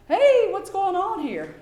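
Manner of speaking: walking into a messy testing room, faking surprise at the mess; mock surprise.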